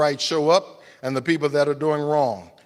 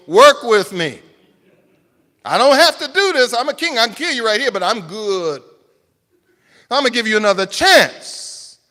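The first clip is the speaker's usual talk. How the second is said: loud, higher boastful generosity; benevolent king; 9 semitones higher, 10 dB louder than usual. Retold or imitated. Preacher voicing Nebuchadnezzar offering a second chance, loud boastful. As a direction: gloat about your mercy. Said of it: "He says".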